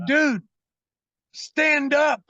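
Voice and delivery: high, loud, drawn-out order; retold commanding shout. Telling panicking friend on toilet what to do, shouted command. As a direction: call it firmly through a closed door. Yelling advice through bathroom door.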